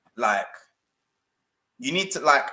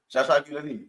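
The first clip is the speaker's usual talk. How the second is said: put-on voice; faster than usual. Repeat, now in a put-on voice.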